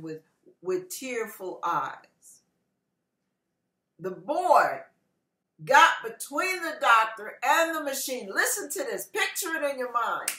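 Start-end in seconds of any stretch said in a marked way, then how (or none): none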